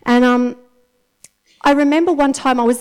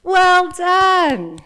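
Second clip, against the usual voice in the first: delighted cheer; 6 semitones higher, much slower than usual. Retold or imitated. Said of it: "he's actually gonna go".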